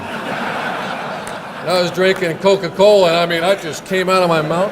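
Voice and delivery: laughing, loud and slow; spluttering with laughter at the memory. Real, not acted. Speaker to the audience, cracking up at his story. Recalling a shocking remark, laughing.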